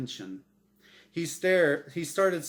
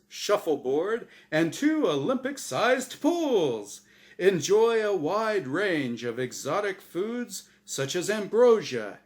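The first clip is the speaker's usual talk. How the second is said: prize announcer Gabe; 7 semitones higher, 5 dB louder than usual. Acted. Gabe describing the heaven cruise to Norm, game show voice. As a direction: sell the cruise like a TV prize reveal.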